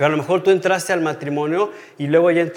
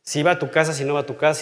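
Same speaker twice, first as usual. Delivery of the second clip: ordinary talk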